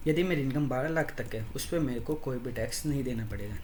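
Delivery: ordinary delivery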